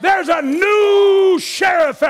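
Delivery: very high preacher's shout, last words stretched out; shouted command. Acted. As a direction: order the shadow out like a triumphant sheriff. God's shouted command to shadow.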